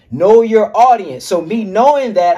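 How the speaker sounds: normal talking voice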